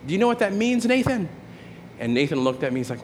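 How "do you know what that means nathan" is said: put-on high, raised preachy yell at a child. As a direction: retell yourself yelling scripture across the house. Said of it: "I sort of yelled out".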